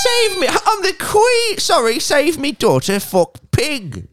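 very high, loud, slow crying shout; hammed-up royal sob; wailing mock Queen. Parody Queen begging the PM, wailing high.

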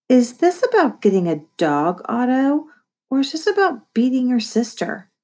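stern mom questioning, pitch climbing; unfriendly. A narrator's reading. Mom confronting Otto over the contest, unfriendly. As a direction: press the question, none too friendly. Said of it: "her tone was anything but friendly".

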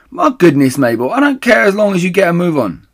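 loud, high, exasperated; impatient call. Acted. Missus Bean calls down, exasperated, loud and high. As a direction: snap at her to hurry.

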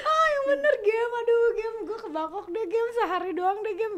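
high, loud mock begging; re-enacted desperate whine, easing off toward the end; whiny pleading. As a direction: act out wheedling your manager, half laughing. Acts out begging manager for one day.

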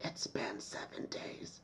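put-on slow eerie whisper of a little girl; creepy child. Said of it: "and she's just like".

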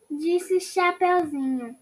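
ordinary delivery, nothing marked

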